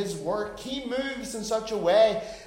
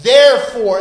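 "Therefore" hit hard and loud; loud falling "Therefore"; stressed therefore; 5 semitones higher, 17 dB louder than usual. Real, not acted. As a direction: slam down on "Therefore". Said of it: "that's really important".

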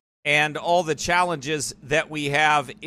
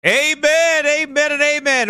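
ringing amen; 9 semitones higher, 6 dB louder than usual. Real, not acted. Opening the broadcast with acclamation.

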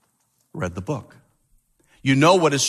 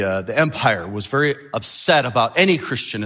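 ordinary talk

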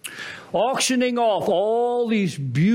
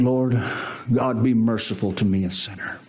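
humble penitent; 6 semitones lower than usual. Acted. Publican praying to God for mercy, low and humble. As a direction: plead low, like an ashamed sinner. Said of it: "the publican would not even look up".

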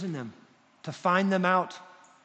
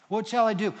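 loud, high, urgent question; eager surrender; 6 semitones higher, 5 dB louder than usual. Retold or imitated. Paul answers Jesus on the road, eager surrender. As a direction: burst out, ready to obey. Repeats Paul's answer with fervor.

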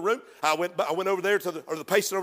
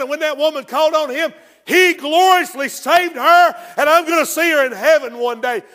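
full-force preaching; 8 semitones higher, 12 dB louder than usual. Real, not acted. Preacher drives home the grace of God to the church. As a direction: preach it out loud and high.

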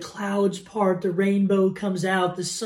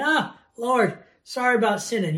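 higher, tossed-off mock sorry; mock casual apology; 4 semitones higher, 5 dB louder than usual. Careless sinner talks to God, mock casual. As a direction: throw out an offhand apology. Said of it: "somebody that's just casually saying".

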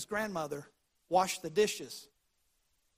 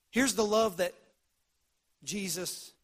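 usual speaking voice

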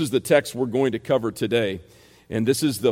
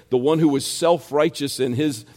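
normal talking voice

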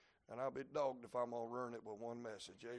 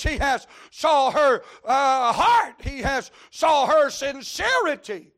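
loud, high, slow hollered sermon; full-force preaching; 12 semitones higher, 22 dB louder, slower than usual. Real, not acted. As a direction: bring it home like a revival preacher.

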